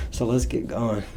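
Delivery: breathless, panting a little; out of breath. Real, not acted. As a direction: talk while catching your breath. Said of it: "I'm a little bit out of breath".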